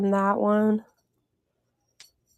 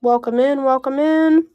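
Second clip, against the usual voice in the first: cheerful greeting; 4 semitones higher, 6 dB louder than usual. Real, not acted. Greeting viewers on live show.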